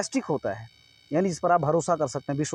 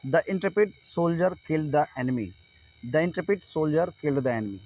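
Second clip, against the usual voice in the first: slow, careful dictation of an example; teacher reading an example sentence slowly; much slower than usual. Shown as a demonstration. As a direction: read each word out slowly for students. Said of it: "for example".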